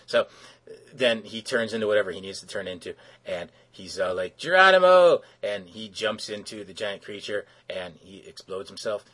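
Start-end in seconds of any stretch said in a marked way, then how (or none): 4.4-5.2 a loud, higher war whoop; mock heroic yell; heroic battle cry. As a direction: leap in yelling it. Metamorpho jumps into the creature.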